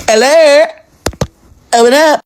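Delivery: high-pitched call through a door. Retold or imitated. Brother outside his sister's hotel room, calling out.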